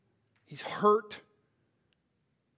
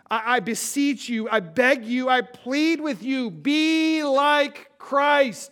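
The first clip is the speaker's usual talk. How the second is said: rising, louder begging, last words very slow; deep plea; 7 semitones higher, 7 dB louder, slower than usual. Retold or imitated. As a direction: plead harder with each phrase. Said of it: "the deep plead".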